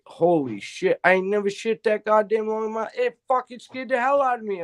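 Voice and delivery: high, stunned exclamation; retold shock at the hospital; astonished. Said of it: "I went".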